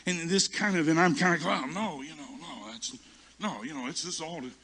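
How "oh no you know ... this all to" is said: soft, stammering denial; sheepish backpedalling. Retold or imitated. Preacher caught out by the Holy Spirit, sheepish soft backpedalling. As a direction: back down quietly, fumbling for words.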